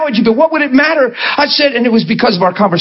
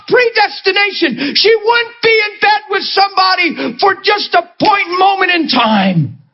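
passionate preaching; 9 semitones higher than usual. Real, not acted. Preacher urging the congregation about a woman's worth, passionate preaching. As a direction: pitch climbing higher, pleading and urgent. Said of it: "I preach very passionate on this topic".

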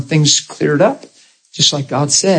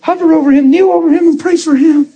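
high, raised calling voice; urgent acted command; 13 semitones higher than usual. Preacher retells calling the crying man up to pray, high urgent call. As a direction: order him up in a high, pushed voice.

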